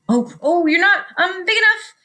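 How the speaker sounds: high, hesitant excuse; nervous backing down. A narrator's reading. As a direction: back out nervously, pitch creeping up. Grouchy ladybug backing down from claws.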